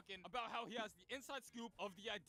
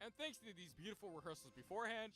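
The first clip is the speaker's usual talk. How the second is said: ordinary talk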